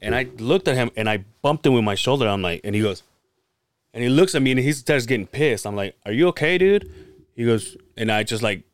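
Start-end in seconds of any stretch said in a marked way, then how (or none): 6.0-6.9 higher, louder irritated check; acted-out annoyance, rising sharply on the last word; annoyed. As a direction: retell an impatient challenge, pitch climbing at the end.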